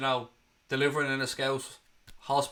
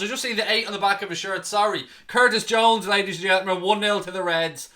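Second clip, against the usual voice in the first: raised, loud and jubilant; excited goal announcement; 5 semitones higher, 6 dB louder than usual. Real, not acted. Fan commentator confirming the scorer to viewers, excited announcement. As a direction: proclaim it loudly, still buzzing from the goal.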